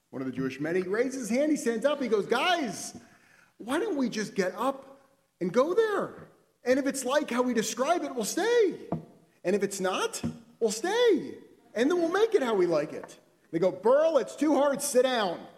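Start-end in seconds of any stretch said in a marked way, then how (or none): 2.3-13.2 high, loud and fired up, pleading with his friends to just go; eager rallying call. Acted. Burl stands up and urges the twelve men to go, loud and eager. 13.7-15.4 high, loud, slow dismissal; loud brush-off. Acted. The men tell Burl to sit down, loud and fed up.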